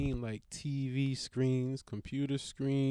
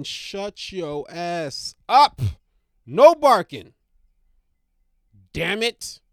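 loud, slow bark of a command; sudden yell; 12 dB louder, slower than usual. Real, not acted. Interrupting his talk to yell at the barking dog. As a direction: cut off the rant to shout at the dog.

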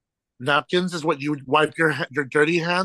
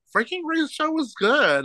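high mock retort; 13 semitones higher than usual. Retold retort to offended lady.

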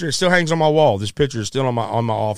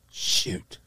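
muttered under the breath, softer; muttered regret; 5 semitones lower, 9 dB quieter than usual. Retold or imitated. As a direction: grumble it to yourself, softly. Inner thought, regret.

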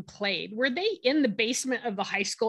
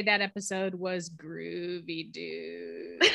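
stoner voice; 4 semitones lower, 5 dB quieter, slower than usual. Acted. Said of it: "That's [name]'s impression of a stoner".

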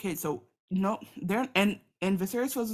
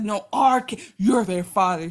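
insistent Rhaenyra; 3 semitones higher, 9 dB louder than usual. Retold or imitated.

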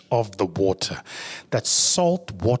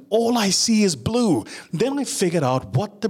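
panicked climax; 10 semitones higher than usual. Acted. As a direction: cry it out as the fear peaks. Panicked peak of story.

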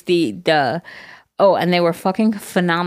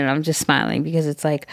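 usual speaking voice

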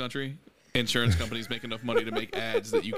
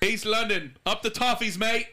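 high, hammed-up cockney British; mock British accent; 8 semitones higher than usual. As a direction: overdo a London accent.

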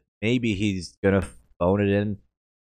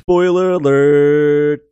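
big, high, drawn-out segment shout; announcer-style shout; 7 semitones higher, 12 dB louder, much slower than usual. Pretend. Co-host announcing the renamed segment, loud hammed-up shout. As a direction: belt it out slowly like a show title.

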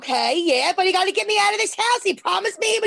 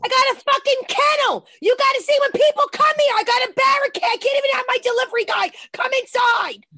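raised-pitch, hollered rant; aggravated; 4 semitones higher than usual. Real, not acted. Mother ranting to the host about dogs, aggravated and higher. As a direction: keep hollering complaints, thoroughly fed up. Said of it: "I'm aggravated".

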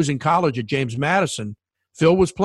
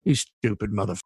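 retold insult; 4 semitones lower, 4 dB quieter than usual. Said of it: "he says".

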